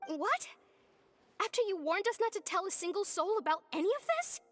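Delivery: high-pitched, incredulous protest; indignant disbelief. Acted. Assistant hearing the boss told the secret, indignant disbelief.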